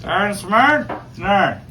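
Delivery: high, loud Newfoundland question and curt answer; thick run-together Newfoundland speech; Newfoundland dialect. Shown as a demonstration. Fishermen swapping the shortest Newfoundland conversation, in dialect. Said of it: "shortest conversation ... between two Newfoundlanders".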